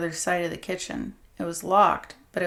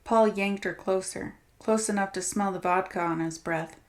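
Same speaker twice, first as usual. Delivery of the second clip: plain reading voice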